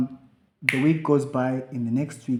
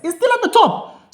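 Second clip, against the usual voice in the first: high-pitched, louder amazement; surprised disbelief; 8 dB louder than usual. Real, not acted. Seeing the podcast email still on top, astonished. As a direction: voice jumps up in shock.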